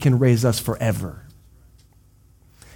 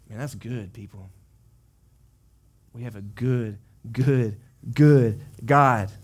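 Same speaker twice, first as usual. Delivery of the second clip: soft tender preaching; 4 semitones lower, 6 dB quieter than usual. Real, not acted. Preacher softens to comfort.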